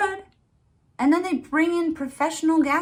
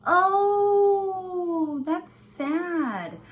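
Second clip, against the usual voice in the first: mock pity; 6 semitones higher, much slower than usual. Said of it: "I said".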